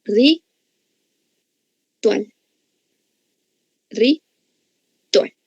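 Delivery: "Ritual" broken as ri... tual, with a long pause; split into syllables. Shown as a demonstration. Teacher segmenting a word for the class, "Ritual" in separated syllables. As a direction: pronounce "Ritual" in two separate beats, RI, then TUAL. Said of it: "tienen que pronunciarla fuerte".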